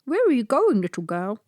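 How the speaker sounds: a slobbering friendly dog asks the way; big rubbery-lipped dog. A narrator's reading. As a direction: talk through big floppy dog lips. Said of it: "With his big rubbery lips he spoke like this".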